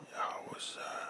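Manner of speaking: imitating a barely audible voice; faint whisper. Imitating his father faintly repeating the name, in a whisper. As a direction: breathe the word like a dying man. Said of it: "I mean, it's that faint".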